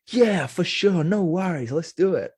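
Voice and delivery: imitating a keen yes, pitched high and loud; high, hearty mock-exclamation of agreement; enthusiastic agreement. Scientist agreeing to a fan's interview request, enthusiastically.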